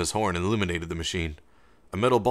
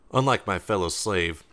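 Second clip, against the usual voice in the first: usual speaking voice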